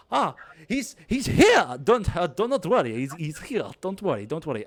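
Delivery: high, loud reassurance, easing down at the end; flustered showman. Acted. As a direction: reassure a waiting crowd in a panic. GM acts flustered juggler reassuring crowd.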